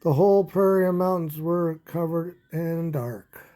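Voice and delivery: lively storybook read-aloud; animated children's story narration. Acted. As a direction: read it like a bedtime picture book. Reading picture book aloud.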